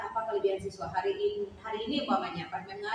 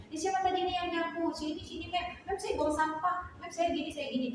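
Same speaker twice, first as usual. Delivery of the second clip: put-on higher, excited kid telling the teacher what he did; a keen pupil tugging for attention, pitched higher; eager student voice; 9 semitones higher than usual. Student eagerly telling the teacher he swept, higher voice.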